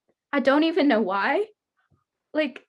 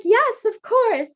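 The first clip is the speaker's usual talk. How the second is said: eager yes; 10 semitones higher, 5 dB louder, slower than usual. Retold or imitated. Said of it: "And then she was like".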